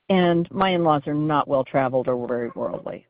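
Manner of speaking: usual speaking voice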